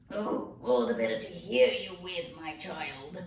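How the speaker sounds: wolf covering its tracks; sly wolf as grandma. Acted.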